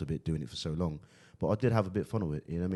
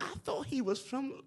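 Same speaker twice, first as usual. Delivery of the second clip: very high, slow, soft squeal; mock disbelief; 6 dB quieter, slower than usual. Somebody in future doubting where he is from, mock disbelief. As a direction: squeak it out as a baffled onlooker. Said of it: "somebody gonna be like".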